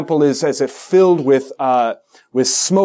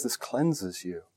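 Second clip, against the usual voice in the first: gentle angel; 5 semitones lower, 13 dB quieter, slower than usual. Retold or imitated. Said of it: "The angel says".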